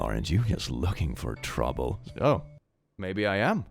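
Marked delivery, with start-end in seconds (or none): 0.0-2.0 low sultry tease sinking lower; flirty sultry tease. Pretend. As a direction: drawl it flirtatiously, dropping low. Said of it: "borderline harassment in the workplace".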